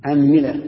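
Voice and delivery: أنملة as "anmila", a first, i on the meem; teacher's clear demo of أنملة with middle i; a then i. Shown as a demonstration. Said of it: "اكسر".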